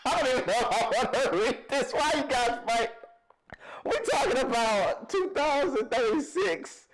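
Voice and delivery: high, incredulous laughing protest; laughing exasperation. Real, not acted. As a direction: plead through real laughter. Laughing at fight in comments.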